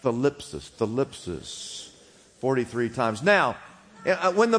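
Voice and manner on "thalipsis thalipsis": Thalipsis said slowly and softly; drawn-out Thalipsis for repeating; Thalipsis, slow, soft, syllable by syllable; say it together. Shown as a demonstration. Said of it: "everybody do this".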